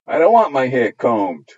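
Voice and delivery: put-on loud, stubborn little girl's refusal; defiant child. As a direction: refuse it while stamping a foot. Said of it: "stamping her foot".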